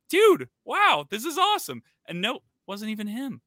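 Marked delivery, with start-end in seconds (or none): 0.1-1.8 high, thrilled exclamation; excited. Retold or imitated. Greeting a supposed old roommate, excited and high. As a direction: gush over the lucky reunion. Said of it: "I was like".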